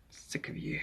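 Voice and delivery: whispered pretend disgust; mock-hostile whisper. Streamer teasing an unknown viewer, mock-hostile whisper. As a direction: whisper it as a joking aside.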